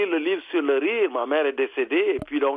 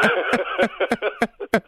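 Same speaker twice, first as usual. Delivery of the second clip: laughing; 6 semitones higher than usual. Real, not acted. Laughs at own joke.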